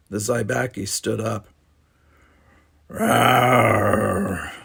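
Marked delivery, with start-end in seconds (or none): 2.9-4.5 mischievous flirty rawr; playful growl. A narrator's reading. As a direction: growl it with a grin. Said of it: "with a mischievous grin".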